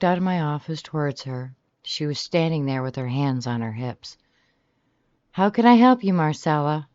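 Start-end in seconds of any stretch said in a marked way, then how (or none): 5.3-6.9 high, loud customer-service greeting; fake-smile politeness. A narrator's reading. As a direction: put on a sweet, false welcome. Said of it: "I asked with a fake smile plastered on my face".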